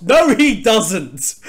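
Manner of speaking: slow, loud, emphatic denial; loud protest. Real, not acted. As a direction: draw out the no in protest. Denies Sonic swims fast.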